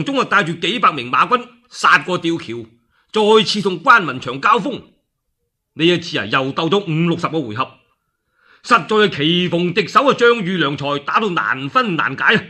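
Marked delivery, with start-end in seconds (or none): none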